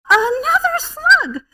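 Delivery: high, loud, drawn-out cry of discovery; excited little child. Retold or imitated. Small boy finding yet another slug, amazed. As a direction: stretch it out in toddler amazement. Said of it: "he would go".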